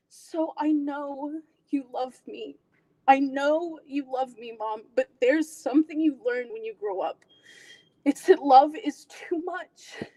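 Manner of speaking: slow, pained confession to mom; tearful, acted resignation; aching dramatic monologue. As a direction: end an audition piece with weighted pauses. Performs an audition monologue's ending.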